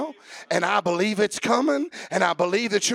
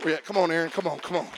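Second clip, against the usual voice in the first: normal talking voice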